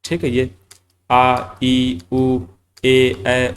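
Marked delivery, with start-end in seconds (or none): 1.1-3.5 each vowel drawn out clearly, one by one; vowels sounded out. Shown as a demonstration. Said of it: "इस प्रकार से". (स्वर अलग-अलग साफ़ बोलकर)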